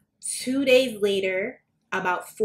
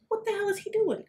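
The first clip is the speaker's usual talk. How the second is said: bewildered; 12 semitones higher, 5 dB quieter than usual. Retold or imitated. Confused by his frantic call.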